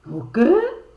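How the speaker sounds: high, loud shocked squeal. Acted. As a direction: squeal in disbelief. Shocked exclamation.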